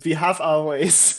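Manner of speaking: slow, deliberate mock German accent; menacing cartoon German catchphrase. Shown as a demonstration. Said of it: "get into your German accent phrase".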